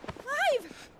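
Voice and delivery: high, glad cry of his name; surprised call. Acted. Mid seeing Clive wake up, high surprised cry. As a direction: burst out with his name in relief.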